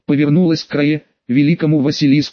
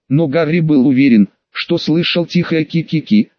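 usual speaking voice

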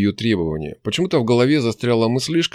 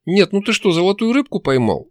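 high-pitched angry jibe; angry outcry; 10 semitones higher than usual. A narrator's reading. Demon scolding the hero's wishes, angrily crying out. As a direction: lash out at him, voice raised high. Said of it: "зло выкрикнула Настя".

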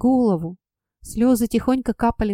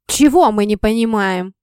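louder, a sharp furious outburst; sharp angry cry; 6 dB louder than usual. A narrator's reading. Father jumping up from the table at his daughter, a loud sharp cry. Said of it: "Вдруг резко вскричал отец".

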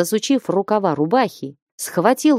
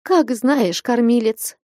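higher, meek and pitiful; plaintive; 5 semitones higher than usual. A narrator's reading. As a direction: answer her husband piteously. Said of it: "жалобно промолвила Аксинья Захаровна". (жалобно)

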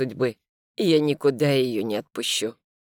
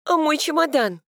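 high worried question, higher; panicked realisation about luggage; alarmed; 12 semitones higher than usual. A narrator's reading. She remembers forgotten suitcase.

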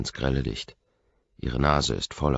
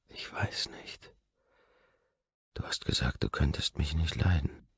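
tearful whisper; 6 dB quieter than usual. A narrator's reading. Crying girl answers her lover in a whisper. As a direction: barely get the words out through tears.

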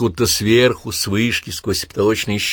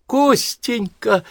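joyful cry; much slower than usual. Acted. Aunt runs out, greets him.

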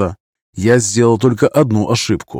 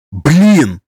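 higher, louder startled curse; short sharp exclamation; 5 semitones higher, 5 dB louder than usual. Acted. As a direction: blurt it out in fear. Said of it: "коротко и чётко обозначил". (испуганное короткое восклицание)

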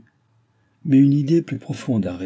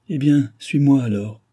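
put-on higher, louder young maid's challenge; Nicole, defiant; 5 semitones higher, 6 dB louder than usual. A narrator's reading. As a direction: toss the dare back at him.

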